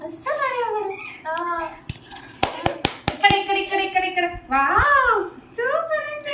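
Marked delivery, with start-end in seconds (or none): 4.5-6.4 loud, high admiring wow; excited praise. Real, not acted. Mother hyping the dress to her baby, excited.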